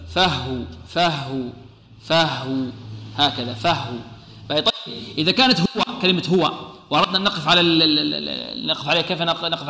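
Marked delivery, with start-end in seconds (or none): 0.1-4.0 "فهو" with the final vowel dropped, low; pausal form demo. Shown as a demonstration. Teacher models stopping on the word. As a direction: stop on "فهو" with a silent ending. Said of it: "كيف نقف عليها؟".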